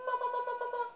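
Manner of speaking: high sing-song Mama, mama, mama; Mama, mama, mama sung out high and bright. Shown as a demonstration. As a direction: chirp Mama, mama, mama way up high to the baby. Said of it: "modelling the word for baby".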